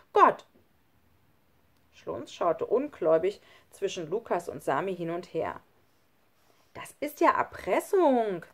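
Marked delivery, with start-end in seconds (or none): 0.1-0.5 put-on emphatic child. Sami answers Schlunz. 6.7-8.4 indignant, raised and slowed; a boy crying foul, drawn out; outraged child. Acted. Schlunz accusing Sami of blackmail, indignant and slow.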